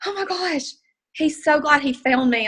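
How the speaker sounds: high, breathy, slow marveling; awed gush. Retold or imitated. Recalling the driver's words, a high awed gush. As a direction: let the amazement lift your voice way up. Awed reaction in a retold moment.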